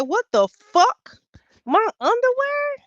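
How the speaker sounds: high, incredulous yelp; retelling finding her underwear in the bag; shocked outrage. Retold or imitated. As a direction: disbelief rising into a squeal.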